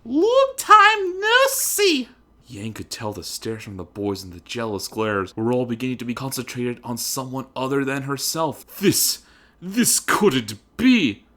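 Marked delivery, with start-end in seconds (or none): 0.0-2.1 very high, loud, slow prissy voice; haughty heiress greeting. A narrator's reading. Weiss taunting Yang. 8.8-11.2 higher, louder, slow stunned gasp; shocked disbelief. A narrator's reading. Yang shocked.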